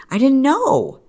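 leaps way up on know; sudden high-pitched disbelief at herself; emphatic realization. Real, not acted. Telling viewers she never knew, voice jumping high.

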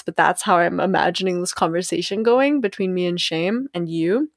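usual speaking voice

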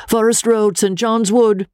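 high, carrying call through the cab window; urgent call to the cabman. Acted. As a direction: call it out to the driver up front. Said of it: "I called to him through the front window".

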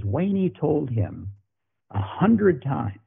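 slow, high, drawn-out incredulity; imitating a coach repeating an excuse he can't believe; mock disbelief. Head coach mocks the secondary coach's excuse, slow and high.

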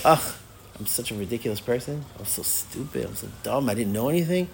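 low, hushed self-reproach; acted dejected muttering to yourself; self-berating. Acts out berating himself.

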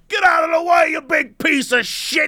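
a hammed-up Boston local yelling you off the road; mock Boston yell. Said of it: "In Boston, they're like".

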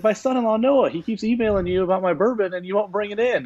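high, put-on Terry voice; Terry complaining to the buyer about his son-in-law, high put-on voice; Terry Bradshaw voice. Retold phone call, Terry voiced.

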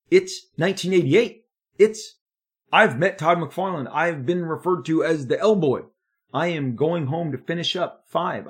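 excited. Real, not acted. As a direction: tell it with rising excitement. Excited recap of career milestones.

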